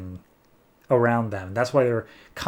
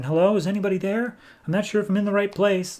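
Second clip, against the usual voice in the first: put-on higher, faster little girl asking at the door; little girl's voice; 8 semitones higher, faster than usual. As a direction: play a lost little girl, pitched up and quick. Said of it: "a little girl's voice at the door".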